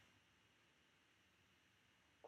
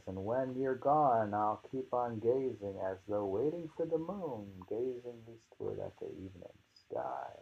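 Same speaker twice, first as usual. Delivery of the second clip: poem reading; 2 semitones higher, 5 dB louder than usual. Acted. Rereads poem.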